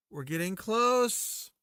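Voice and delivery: slow, lifted repeat; drawn-out high. Pretend. Repeats phrase differently.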